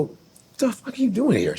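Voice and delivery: a surprised brother meeting his sister; shocked at sister. Retold or imitated. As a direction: caught off guard at the show. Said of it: "I go".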